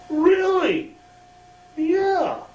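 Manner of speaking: very high, eager voice; Carl's voice. Retold or imitated. Carl insisting to Kerry he wants to, very high. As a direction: pitch it way up, keen to please.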